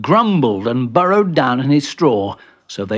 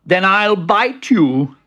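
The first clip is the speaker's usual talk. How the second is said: loud, slow, raised threat; firm threat; 6 semitones higher, 5 dB louder, slower than usual. Acted. Bertie threatening balky Benji, slow firm threat. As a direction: spell out the threat slowly.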